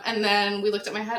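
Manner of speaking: normal talking voice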